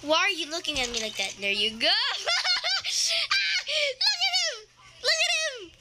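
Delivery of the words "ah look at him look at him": much higher, laughing squeals; excited laughing shout. Real, not acted. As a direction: squeal with delight at the screen. Baby makes a funny face.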